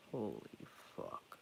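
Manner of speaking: low, slow, breathless awe; terrified whisper. Retold or imitated. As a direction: let the fear drain the voice to a whisper. Facing Ahriman in dream.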